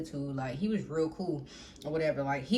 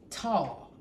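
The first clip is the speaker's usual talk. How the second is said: ordinary delivery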